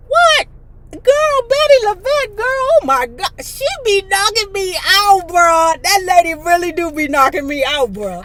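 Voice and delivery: high, loud, gushing disbelief; excited outburst. Real, not acted. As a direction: burst out high and loud, then ease down. Excited outburst interrupting the reading.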